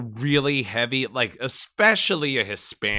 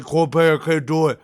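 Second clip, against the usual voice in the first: put-on Spanglish accent; 4 semitones higher, 5 dB louder than usual. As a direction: whine of protest in an imitated Spanglish accent. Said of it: "still probably has that fucking accent".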